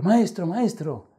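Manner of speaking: high, slow cry of maestro; panicked call to the teacher; shouting for help. Retold or imitated. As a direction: call out desperately from the water. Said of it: "le gritó al maestro".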